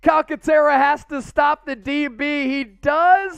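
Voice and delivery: high, loud sports commentary at a big play; thrilled commentator, easing off at the end; excited play-by-play. Real, not acted. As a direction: call the touchdown live at full pitch. Live play-by-play of interception touchdown.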